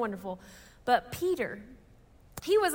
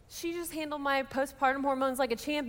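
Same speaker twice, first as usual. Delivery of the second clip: ordinary delivery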